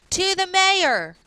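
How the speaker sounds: high, loud and drawn out; eager classmate. Acted. Another classmate calling out to the class, eager and slow. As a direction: stretch it out like an excited kid.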